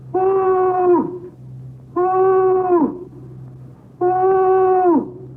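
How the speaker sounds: high, hooting owl call, held long; owl imitation. [name] hooting to thrill the boys outside, owl hoot. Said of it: "do my owl imitation".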